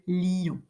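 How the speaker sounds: LION in two syllables, li-yon; diérèse. Shown as a demonstration. Teacher giving a second example, word pronounced in two. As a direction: split LION as li-on. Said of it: "on le prononce en deux".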